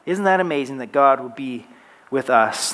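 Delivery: ordinary talk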